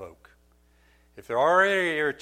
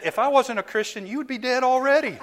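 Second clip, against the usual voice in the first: laughing mock threat; 12 semitones higher, 6 dB louder than usual. Retold heated reply to daughter.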